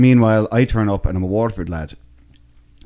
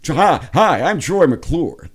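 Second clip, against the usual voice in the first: put-on American twang, higher-pitched and drawn out; Troy McClure; 7 semitones higher, much slower than usual. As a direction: introduce yourself with a hammed-up American twang. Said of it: "add a little bit of an American thing in there, a little bit of a twang".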